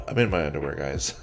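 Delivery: tired host telling friends to leave; weary deadpan. Retold or imitated. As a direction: sigh it out, exhausted. Weary reply after sigh.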